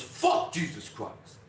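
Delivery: slow, held-back replay of a shout; restrained imitation of an authoritative shout; retold shout. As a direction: stretch out the outburst without full volume. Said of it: "this man shouts".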